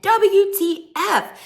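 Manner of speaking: high, loud, slow mock shock; Wendy's text read aloud in shock. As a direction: spell it out aghast. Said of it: "she said".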